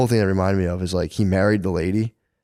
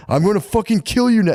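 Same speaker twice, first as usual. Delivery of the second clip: higher, louder mock drunken threat; 13 semitones higher, 4 dB louder than usual. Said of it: "he's like".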